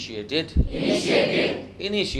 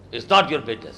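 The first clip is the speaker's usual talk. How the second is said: louder, hard-edged retort with a laugh behind it; frowning anger; 6 dB louder, much slower than usual. Shown as a demonstration. Teacher demonstrates an angry refusal with a frown. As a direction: say it with a frown, pushing him away. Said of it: "माथे पे त्योरी चढ़ा के बोलो".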